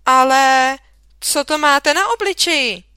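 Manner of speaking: loud, high startled "Ale"; startled exclamation. A narrator's reading. Teacher spotting the nose on a pupil, startled outburst. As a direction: burst out in surprise.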